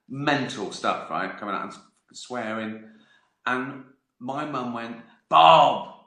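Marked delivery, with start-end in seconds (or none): 5.3-6.0 loud, high call across the room; calling Bob. Retold or imitated. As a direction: mum shouting for Bob. Mum calls out to Bob.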